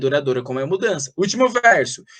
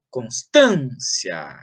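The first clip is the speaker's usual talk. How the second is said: "constância" stretched slowly on TAN; stress on AN; 7 semitones lower, much slower than usual. Shown as a demonstration. Teacher shows where rhyme starts, "constância" drawn out on TAN. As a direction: lean long on the "tân" of "constância". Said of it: "a sílaba tônica é an".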